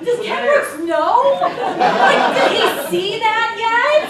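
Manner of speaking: high, loud laughing disbelief; giggly shocked gossip; excited disbelief. Retold or imitated. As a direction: gasp it out, delighted and scandalized. Retold excited gasping question.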